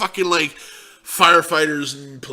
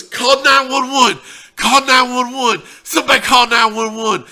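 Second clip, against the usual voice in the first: frantic shout; 8 semitones higher, 6 dB louder than usual. Retold or imitated. Friend yelling to the staff for help, a frantic shout. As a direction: yell it across the restaurant, panicked.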